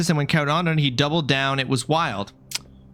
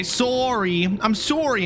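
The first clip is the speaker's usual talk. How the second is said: high, drawn-out Canadian "sorry"; hammed-up Canadian politeness, pitched high; Canadian apology; 8 semitones higher, slower than usual. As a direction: play up the Canadian accent on an apology. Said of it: "I'm so Canadian today".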